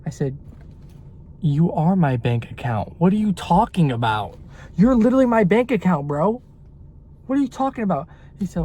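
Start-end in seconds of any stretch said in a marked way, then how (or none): 1.4-8.1 angry retort. Retold or imitated. Arguing with the support agent.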